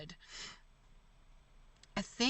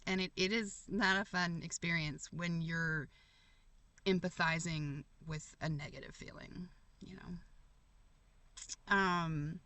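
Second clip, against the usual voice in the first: ordinary talk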